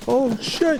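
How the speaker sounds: loud high shocked outburst; startled yell. Real, not acted. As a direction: yell out caught off guard. Suddenly shot at in game.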